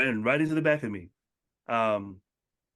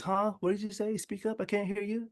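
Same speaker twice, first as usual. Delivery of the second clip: people asking to speak up; 9 semitones higher than usual. Retold or imitated. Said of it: "Everybody was".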